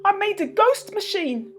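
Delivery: high, meek little man's voice; timid little man. Acted. Mister Bashfellow explains his machines to the kids, high and timid. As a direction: confess shyly.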